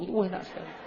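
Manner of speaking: ordinary talk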